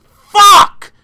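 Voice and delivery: a huge, high, loud yell of frustration; furious shout. Retold or imitated. Sitting in the theater, screaming in frustration. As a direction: explode with the word. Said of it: "I was just sitting there like".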